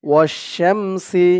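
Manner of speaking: slow drawn-out ওয়াশ-শামসি; slow model word. Shown as a demonstration. Teacher modelling the word for students, slowly. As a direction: stretch ওয়াশ-শামসি syllable by syllable. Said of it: "কয়েকবার practice করলেই".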